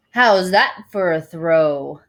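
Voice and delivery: loud, slow and proud. A narrator's reading. Limo driver boasting to the crowd about his throw, proud. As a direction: show off to the crowd. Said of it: "asked proudly to the crowd".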